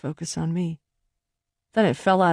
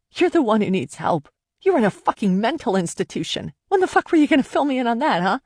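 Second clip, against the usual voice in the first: louder and faster, lashing out; angry outburst; 4 semitones higher, 4 dB louder than usual. Acted. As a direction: throw the accusation back in his face. She turns on him in anger.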